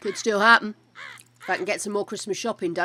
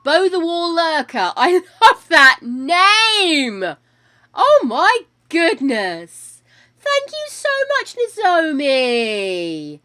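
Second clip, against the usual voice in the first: high, loud, slow gushing with laughing breaks; much higher, louder drawn-out thrilled thanks; overjoyed; 7 semitones higher, 12 dB louder, slower than usual. Real, not acted. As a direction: melt with delight at a gift. Overjoyed at gift from neighbour.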